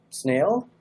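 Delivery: usual speaking voice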